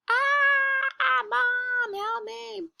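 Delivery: put-on very high squeaky baby cry; a tiny child squealing for mom; baby crying for help. Teacher voices baby Bill calling Mom from the river, high squeal.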